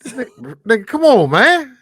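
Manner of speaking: high-pitched, loud exasperated call; impatient yell. Real, not acted. Friend yelling at him to hurry up and pay the bill, exasperated.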